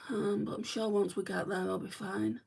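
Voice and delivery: ordinary delivery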